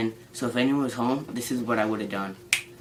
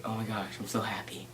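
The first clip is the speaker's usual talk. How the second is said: relieved whisper; 10 dB quieter than usual. Retold or imitated. Relieved at finding quesadillas.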